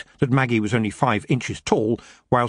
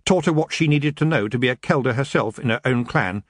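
ordinary delivery, nothing marked

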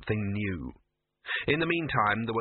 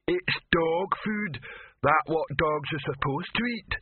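slow, lumbering simpleton, pitched up; Detritus; 6 semitones higher, slower than usual. Acted. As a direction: plod through it word by word. Detritus answers the other handlers.

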